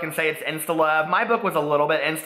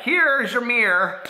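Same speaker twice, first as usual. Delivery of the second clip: mock high drawn-out quip; 8 semitones higher, 4 dB louder, slower than usual. Mock line from the narrator.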